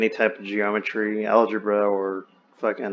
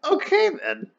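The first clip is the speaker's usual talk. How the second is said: squeaky high, bemused "Okay, then."; dazed okay. Retold or imitated. To friend about skipping grades, high bemused falsetto. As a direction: shrug it off in a jumped-up falsetto. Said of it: "I was like".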